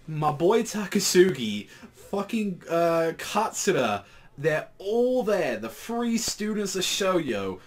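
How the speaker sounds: high, loud, excited gushing; hyped up. Real, not acted. Fan gushing to viewers about the final chapter, pitched high and excited.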